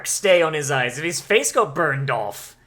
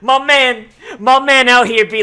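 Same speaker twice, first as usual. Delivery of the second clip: much higher, much louder hype shout; hyped cheer; 5 semitones higher, 8 dB louder, slower than usual. Real, not acted. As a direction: cheer him on like a champion.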